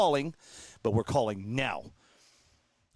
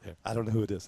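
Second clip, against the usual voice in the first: ordinary delivery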